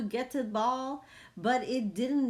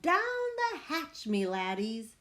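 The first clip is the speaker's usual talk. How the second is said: pirate toast; 9 semitones higher, 3 dB louder than usual. Acted. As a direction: roar it as the table is pounded. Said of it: "pounded his fist on the table and yelled".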